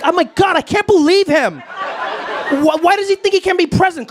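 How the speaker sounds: high-pitched, exasperated incredulity; mock disbelief. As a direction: imitate outraged people who cannot believe it. Mimics incredulous critics of Biden.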